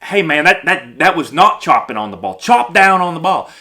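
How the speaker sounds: loud, exasperated correction; scolding coach. Acted. Coach correcting a hitter's swing, loud and scolding. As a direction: raise your voice and insist.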